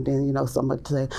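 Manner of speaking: ordinary delivery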